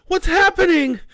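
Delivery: high, loud frightened question; panicked. Acted. Panic in the truck.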